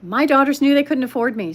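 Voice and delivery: ordinary talk, nothing marked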